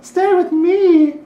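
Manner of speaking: high, slow, sweet little halfling plea; cute halfling voice. Acted. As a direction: coax them to stay, tiny and sweet. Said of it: "this cute, like, halfling voice".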